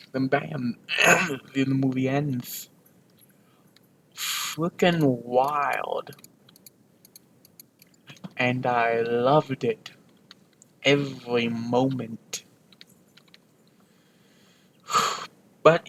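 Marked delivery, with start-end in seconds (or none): none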